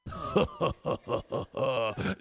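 low, measured anime villain laugh. Pretend. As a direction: laugh like the anime main guy, "ho ho ho". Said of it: "working on my anime laugh".

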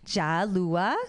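"Jalua" in a Northern Vietnamese accent, lifted at the end. Shown as a demonstration. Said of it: "understand Northern Vietnamese accent".

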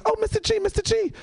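high, insistent child impression; excited little kid. Kid calling out to his teacher, high and eager. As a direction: squeal for the teacher's attention like a kid. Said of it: "he was like".

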